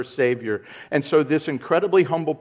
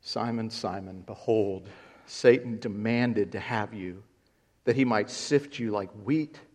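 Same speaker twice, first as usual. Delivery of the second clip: soft, lower, tender warning; gentle Jesus; 3 semitones lower, 6 dB quieter than usual. Acted. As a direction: warn him softly like a loving teacher. Jesus speaking gently to Peter.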